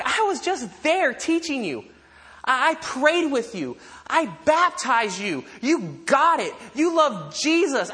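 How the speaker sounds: high-pitched, incredulous reproach; mad, hurt disbelief. Acted. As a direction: plead with converts who have walked away, exasperated. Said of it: "what's he so mad about?".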